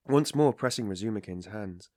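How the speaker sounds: plain reading voice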